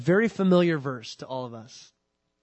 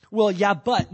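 defensive excuse; 4 semitones higher, 9 dB louder, slower than usual. Acted. Adam making excuses to God.

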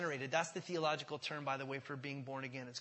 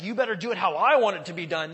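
loud, domineering mock command; bossy demand; 3 semitones higher, 16 dB louder than usual. Preacher imitating a demanding God he rejects, loud overbearing order. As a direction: thunder it out as a demanding boss. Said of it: "not because he's like".

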